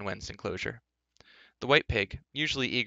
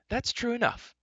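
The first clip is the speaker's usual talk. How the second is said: put-on much higher-pitched girlish voice; princess; 14 semitones higher than usual. Princess agreeing with farmhand's advice, high-voiced chiding.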